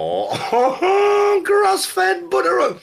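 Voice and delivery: very high, slow, laughing cry of delight; mock swooning. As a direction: gush over it in a hammed-up high squeal. Mock swoon over grass-fed butter.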